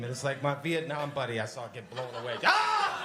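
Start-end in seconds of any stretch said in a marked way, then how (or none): 2.4-3.1 high, loud mock scream of horror. War flashback of a pug, sudden high scream.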